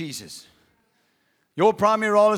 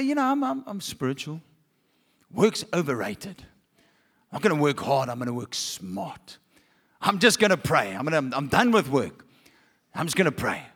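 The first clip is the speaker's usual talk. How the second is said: lightly voiced pious type, lower; mock-pious believer; 6 semitones lower than usual. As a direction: put on a slightly lofty, low spiritual voice.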